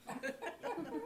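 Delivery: high, soft breathy giggle; a little breathy giggle; soft laugh. Real, not acted. Laughing mid joke.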